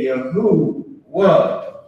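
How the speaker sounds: Yahuwah slow as yah-hoo-wah, three syllables; wrong pronunciation. Shown as a demonstration. Teacher imitating the fake groups' name, slow and mocking. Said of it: "This is what they say".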